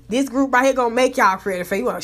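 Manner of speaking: ordinary talk, nothing marked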